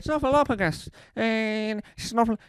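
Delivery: put-on slurred, loud drunk singing. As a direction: belt it like a drunk. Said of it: "That's how you say Snuffleupagus as a drunk person".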